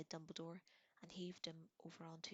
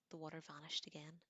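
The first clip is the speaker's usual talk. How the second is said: ordinary delivery, nothing marked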